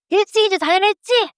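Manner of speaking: high-pitched scolding nag; nagging spouse. Acted. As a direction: scold a partner like family.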